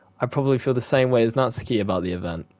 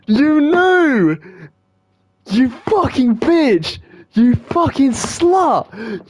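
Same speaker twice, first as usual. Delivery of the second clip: high, slow furious screaming; furious shout; 11 semitones higher, 5 dB louder, much slower than usual. Real, not acted. Gamer screaming at Monika for knowing all along. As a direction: scream it in outrage.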